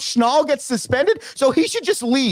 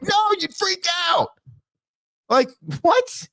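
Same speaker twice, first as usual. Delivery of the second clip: outburst; 9 semitones higher than usual. Acted. Coach reacting to being sent off.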